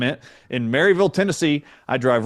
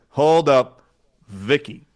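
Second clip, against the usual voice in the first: retold firm interruption; slower than usual. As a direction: stop them short, then drop on the name. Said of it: "I went just like this".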